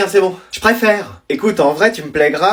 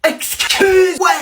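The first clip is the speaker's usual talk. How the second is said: put-on old grandma voice; 8 semitones higher, much slower than usual. Grandmother's recurring apology gag.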